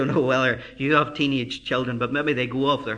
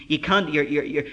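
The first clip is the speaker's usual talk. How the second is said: ordinary talk, nothing marked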